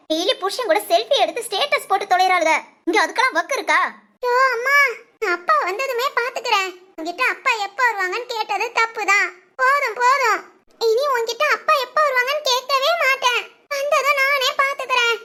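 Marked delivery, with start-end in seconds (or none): 4.3-15.2 put-on high-pitched child giving up on asking; fed-up child. Child fed up with mother's answers about father, exasperated. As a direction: voice a small, exasperated kid.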